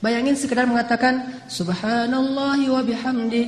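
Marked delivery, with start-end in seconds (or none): 1.5-3.5 slow, drawn-out chanted recitation; recited dhikr. Acted. Preacher recites the dhikr, slow chanted tone. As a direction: intone it slowly like prayer.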